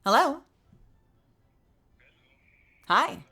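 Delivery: bright polite phone voice; customer service voice. Pretend. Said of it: "here's a customer service voice".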